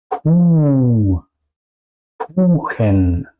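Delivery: Ku and Kuchen with a long, held "oo"; long vowel. Shown as a demonstration. Teacher models the U sound, long vowel. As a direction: draw out the U in Ku and Kuchen. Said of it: "tengo después una H".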